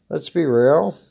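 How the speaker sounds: ordinary talk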